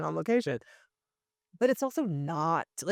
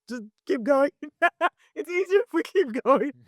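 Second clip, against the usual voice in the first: laughing while insisting; 10 semitones higher, 6 dB louder than usual. Retold or imitated. Laughing through a retold crying scene.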